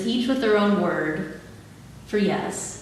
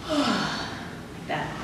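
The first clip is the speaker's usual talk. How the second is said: breathy whisper; 3 semitones higher, 7 dB quieter than usual. Shown as a demonstration. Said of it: "So it's gonna look like this".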